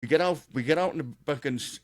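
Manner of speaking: ordinary talk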